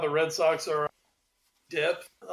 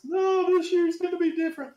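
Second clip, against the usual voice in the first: higher, bright mock-fan insistence; mock hopeful fan; 10 semitones higher than usual. Mocking PSG fans' yearly hope, high mock optimism. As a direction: play the deluded believer.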